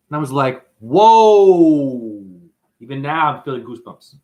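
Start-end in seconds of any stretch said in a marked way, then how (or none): 0.8-2.2 loud, long-drawn whoa of astonishment; big retold gasp of wonder; amazed whoa. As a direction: re-enact being blown away, drawing it out. Retold amazed reaction to the dream.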